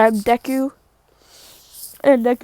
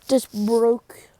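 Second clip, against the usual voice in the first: plain reading voice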